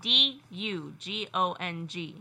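"D U G O N G" spelled slowly, letter by letter. Shown as a demonstration. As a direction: spell out "D U G O N G" one letter at a time. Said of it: "Spell it for him".

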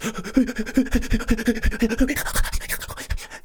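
big gasping breath. Shown as a demonstration. Demonstrating a child's deep breath.

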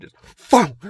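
shouted boom; sudden loud boom. Retold or imitated. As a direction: blurt out an explosive boom. Mimics explosion in forest.

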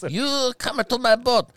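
put-on high, slow caricatured Chinese accent; mock Chinese accent. Emperor ordering the dragon aboard, mock Chinese accent. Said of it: "can you do a Chinese accent?".

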